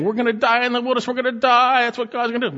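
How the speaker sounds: high, fast, panicky mock whine; mock the grumblers with a high fast whine; whining Israelites. Preacher mocking the Israelites' complaining, high panicky whine. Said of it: "You notice they're saying".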